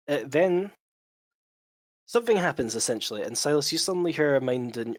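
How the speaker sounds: ordinary talk, nothing marked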